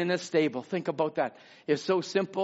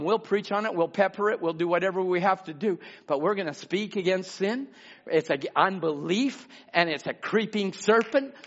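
usual speaking voice

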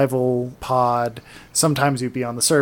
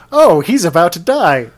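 mock alert; 4 semitones higher, 7 dB louder than usual. Voices the game's gleeful trigger.

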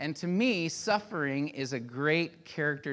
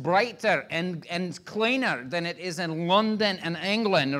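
loud, higher, thick Scottish brogue; proud Scottish coach driver showing off the country; Scottish accent; 5 semitones higher, 8 dB louder than usual. Retold or imitated. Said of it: "was Scottish".

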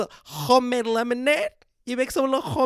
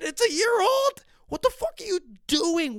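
incredulous yell; 9 semitones higher than usual. Real, not acted. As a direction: yell it at someone who can't believe it. Ranting at Facebook posters.

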